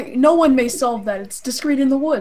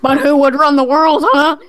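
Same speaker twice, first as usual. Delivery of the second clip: loud, raised-pitch heckle; mock objector; 5 semitones higher, 7 dB louder than usual. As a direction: heckle like an indignant doubter, louder and higher. Mimicking objectors to anarchy.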